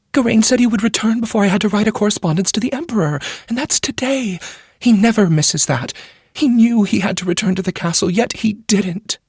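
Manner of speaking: a court lady pouring out her worry; troubled noble lady. A narrator's reading. Wheeler anxious.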